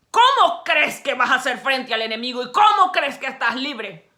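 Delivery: loud, driving sermon delivery; fiery preaching. Acted. Preacher urging the congregation, loud and fiery.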